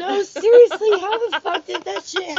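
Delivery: high and loud, fed up; exasperated outburst. Real, not acted. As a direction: burst out in disbelief. Exasperated outburst about racism.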